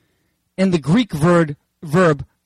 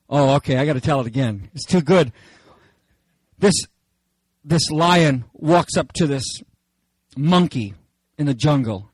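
ordinary talk

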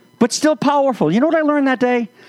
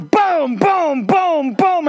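high mock booms, one after another; thudding booms; 10 semitones higher, much slower than usual. Elephant slamming the lion: high mock thudding booms. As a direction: beat it out like a body being slammed. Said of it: "he goes".

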